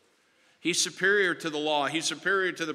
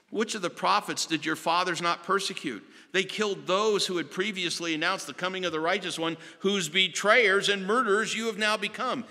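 rising, higher preaching that swells to the end; fiery rebuke; 6 semitones higher than usual. Acted. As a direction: preach it like Stephen accusing his judges. Stephen's rebuke of the council.

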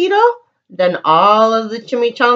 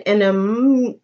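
plain reading voice

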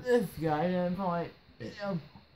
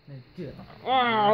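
stern order; 5 semitones higher, 10 dB louder, slower than usual. Acted. Boss orders manager out.

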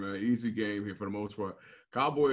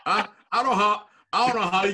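laughing; 12 semitones higher, 6 dB louder, slower than usual. Real, not acted. Laughing while protesting teammate's remark.

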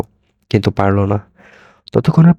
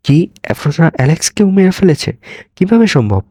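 shocked disbelief; 7 semitones higher than usual. A narrator's reading. Shocked hero hears of murder.

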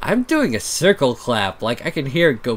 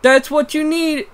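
much higher loud character voice; Pepper voice; 6 dB louder than usual. Acted. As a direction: squawk it as Pepper. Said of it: "channel Pepper".

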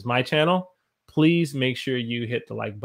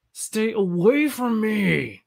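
high, slow, stretched whine; whiny high plea; 9 semitones higher, much slower than usual. Shown as a demonstration. Teacher acting out chat phrase, high slow whine. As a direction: beg them to stay back.

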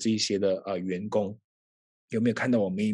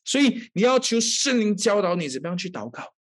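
fervent preaching; 5 semitones higher than usual. Acted. Pastor exhorting the congregation, fervent preaching. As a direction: drive the point home to the congregation.

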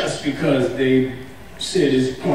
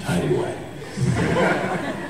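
whispered laugh; 9 semitones lower, 7 dB quieter, much slower than usual. Real, not acted.

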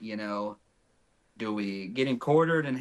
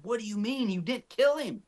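retold disbelief, pitched up high; incredulous; 9 semitones higher than usual. Hunting partner questions hunter who won't shoot, incredulous. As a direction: imitate a baffled partner, voice climbing high.

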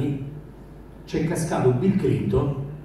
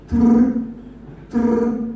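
phone ring imitation; 8 semitones higher than usual. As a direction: buzz it out like an old phone.

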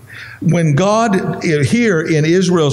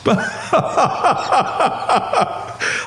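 breathy embarrassed laugh; 8 semitones higher, 4 dB quieter than usual. Real, not acted. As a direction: laugh at your own slip. Laughs at his own voice crack.

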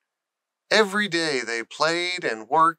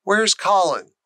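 higher, louder demand; 6 semitones higher, 5 dB louder than usual. Acted. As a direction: insist on an answer at once. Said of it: "he demanded".

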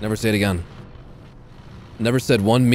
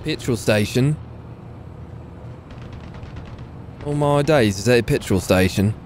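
put-on British criminal accent; 4 dB quieter than usual. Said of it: "I sound like a criminal".

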